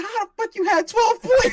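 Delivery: high, loud disbelieving question; hammed-up shocked teammate; mock incredulous friend. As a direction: squeal it in shock at the score. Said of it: "Chris said".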